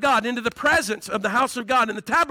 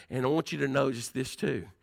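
ordinary talk

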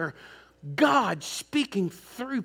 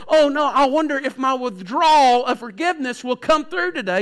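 worried mock voice; 4 semitones higher, 6 dB louder than usual. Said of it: "you don't have to go".

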